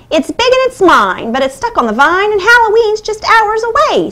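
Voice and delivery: high, fast witch voice. A narrator's reading. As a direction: squawk it like a cackling witch. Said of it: "said the witch".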